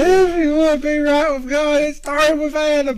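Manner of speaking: high, loud hammed-up preaching; mock preacher. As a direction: preach it to the back row.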